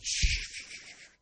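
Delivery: long, forceful shushes; shushing. Acted. Onlooker silencing a noisy man in church, shushing. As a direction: hush someone making a scene. Hushing the yelling Bartimaeus in church.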